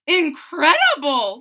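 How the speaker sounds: loud astonished exclaim; amazed fairy. Acted. Tooth Fairy failing to pull tooth, amazed. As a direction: marvel at the stuck tooth.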